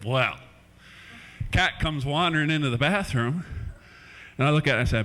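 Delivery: ordinary talk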